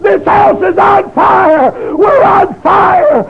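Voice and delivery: high, loud and slow terrified cry; panicked scream. Retold or imitated. Wife waking to her burning house, screaming. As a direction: scream in terror at the flames. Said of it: "his wife got out of bed and said".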